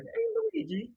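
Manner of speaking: very high cartoon Mario voice; realistic Mario impression. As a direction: chirp it like a silly Mario. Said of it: "Mario Realistic would be like".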